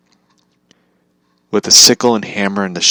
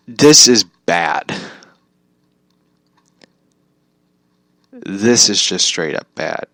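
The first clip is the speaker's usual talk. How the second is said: slow emphatic verdict; slower than usual. Real, not acted.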